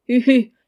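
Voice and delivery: a witless hu-hu laugh; idiotic laugh. A narrator's reading. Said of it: "dit-il avec un rire idiot". (rire idiot et sonore)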